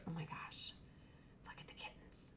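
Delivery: hushed whisper over kittens; soft awed whisper. Real, not acted. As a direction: breathe it, barely audible.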